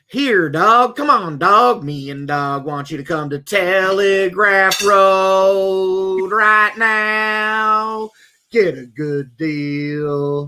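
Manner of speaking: slow, drawn-out ad voice; imitating commercial. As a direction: copy the old car ad. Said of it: "remember this one".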